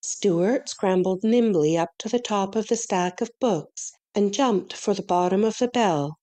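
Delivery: usual speaking voice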